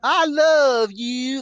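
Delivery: high, loud, drawn-out wrestling character catchphrase; Brother Love. Acted. Host doing a wrestling character's catchphrase, hammed-up high drawl. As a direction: stretch each word right out, high and loud. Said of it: "That's Bruce Pritchard. Uh, Brother Love".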